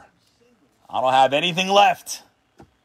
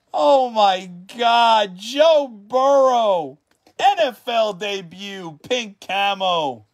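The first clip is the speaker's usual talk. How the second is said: high, slow, savoring it; excited awe; 7 semitones higher, slower than usual. Real, not acted. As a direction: still reeling with joy. Still excited over the card.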